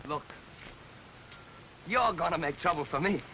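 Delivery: low, restrained reply; subdued retort. Acted. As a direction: say it low, holding back. Wary answer to policeman's threat.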